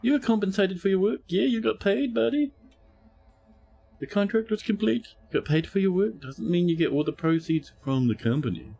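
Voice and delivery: smug high-voiced rival. Quarrel between ship captains.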